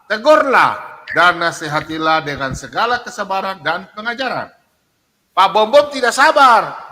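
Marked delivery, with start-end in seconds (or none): 5.3-6.9 emphatic. Real, not acted. Preacher insisting on his point.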